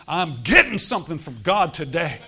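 loud, fired-up preaching vow; determined declaration. Acted. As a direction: shout it like you won't leave empty-handed.

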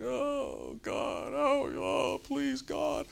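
high, pleading falsetto wail; hammed-up begging cry to God; mock desperate wail. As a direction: ham up a desperate plea. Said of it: "you're like".

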